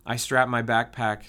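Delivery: usual speaking voice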